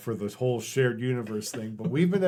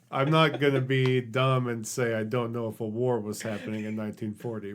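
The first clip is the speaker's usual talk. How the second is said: ordinary talk, nothing marked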